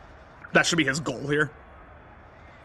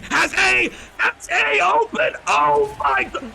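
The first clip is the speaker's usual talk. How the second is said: much higher and louder, drawn out; rising excited call; 13 semitones higher, 4 dB louder, much slower than usual. Real, not acted. Gamer calls the winning pass, rising excited yell. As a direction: build the call to the catch. Calling a touchdown pass live.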